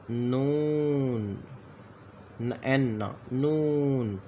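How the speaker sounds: नून with the oo held very long; stretched letter name. Shown as a demonstration. Teacher to students, slowly stretched letter name नून. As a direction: let नून ring out slowly. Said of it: "teacher sounding out letter".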